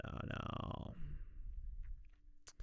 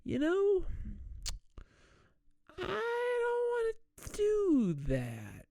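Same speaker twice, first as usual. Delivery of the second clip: much higher, slow and near tears; high whiny crying voice; slower than usual. Retold or imitated. Harold refusing his worried friend, in a high, tearful whine. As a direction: whine like a lovesick fool. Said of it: "he's just like".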